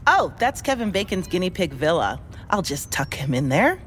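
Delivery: ordinary delivery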